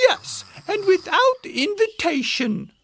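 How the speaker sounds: very high-pitched mother bear; indignant Mama Bear. A narrator's reading. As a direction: chime in high and offended. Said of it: "added Mama Bear".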